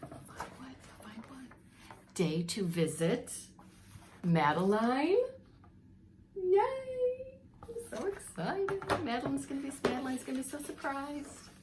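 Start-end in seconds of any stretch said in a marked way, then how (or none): 0.0-1.5 soft whispered question; whispered prompt. Real, not acted. Reader hinting to the children to finish the line, whispered. 6.4-11.5 put-on high, squealing kids' excitement; excited children. Little girls cheering before visiting their friend in hospital, excited.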